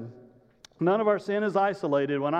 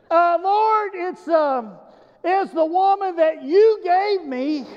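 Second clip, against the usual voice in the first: much higher, louder, slow halting excuse; Adam blaming further; 10 semitones higher, 6 dB louder, slower than usual. Acted. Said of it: "Adam doesn't know when to stop".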